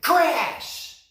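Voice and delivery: sudden loud high crash sound. A narrator's reading. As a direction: shout the crash like a sound effect. Sound effect in story.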